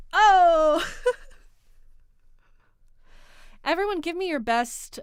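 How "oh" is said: very loud, high whoop after a joke; triumphant shouted "oh!". Pretend. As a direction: crow at your own punchline. Crowing after own "deez nuts" joke.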